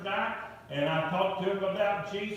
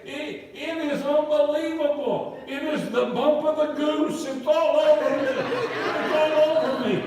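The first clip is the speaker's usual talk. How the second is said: much higher and louder, breathless wonder, slowing at the end; imitating an overjoyed friend, laughing through it; excited; 8 semitones higher, 7 dB louder than usual. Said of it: "he said with an excited voice".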